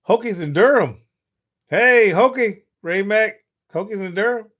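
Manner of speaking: loud, high call to a viewer; hey, call it across the room. Real, not acted. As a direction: shout out the greeting. Calling out to viewer.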